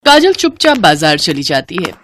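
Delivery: ordinary delivery, nothing marked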